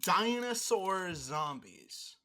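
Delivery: slow, announced like a movie title; dramatic pitch. Pretend. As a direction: sell it like a trailer.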